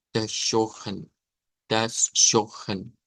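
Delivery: "Das Schochen" slowly, with SH and a throaty J for R; corrected pronunciation. Shown as a demonstration. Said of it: "una J para esa R regular".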